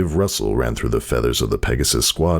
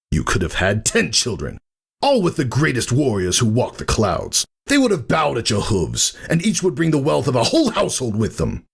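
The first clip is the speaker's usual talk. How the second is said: high, rising scorn; scornful brother; 12 semitones higher than usual. A narrator's reading. Thunderhead to his sister, rising scorn. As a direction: paint the life she threw away.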